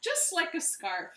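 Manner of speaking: usual speaking voice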